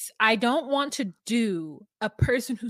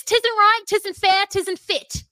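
regular Cornish-drama folk, louder and higher; loud, high-pitched British country-folk accent; regional British commoner accent; 10 semitones higher, 9 dB louder, slower than usual. Acted. Podcast host imitating Poldark's regular people, British commoner accent. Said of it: "who speaks like this".

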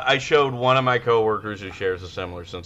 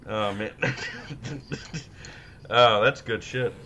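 usual speaking voice

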